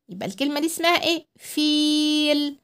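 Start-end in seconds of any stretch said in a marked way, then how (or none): 1.4-2.6 فيل with a stretched vowel; long ii in فيل. Shown as a demonstration. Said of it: "هنطول صوتنا قوي".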